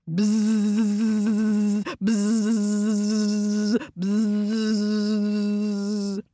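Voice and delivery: long, loud sustained buzz; steady drawn-out bee drone. A narrator's reading. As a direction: buzz like a swarm of angry bees.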